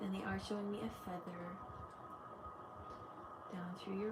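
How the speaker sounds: soft, quiet meditation voice guiding through each chakra; guided meditation. Acted. Leads a chakra grounding meditation.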